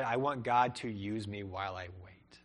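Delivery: ordinary delivery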